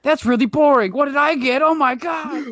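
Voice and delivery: high loud YouTuber shriek; shrieking jackpot winner. Retold or imitated. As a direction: scream over the gift for the camera. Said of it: "My kid's a YouTuber".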